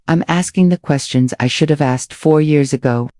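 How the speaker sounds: ordinary delivery, nothing marked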